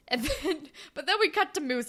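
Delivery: ordinary delivery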